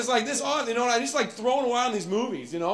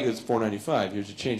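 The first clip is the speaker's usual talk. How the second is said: lower, softer cashier; flat clerk; 10 semitones lower, 5 dB quieter than usual. Retold or imitated. Cashier giving change, lower and dull. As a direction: hand over change dully. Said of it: "He's like".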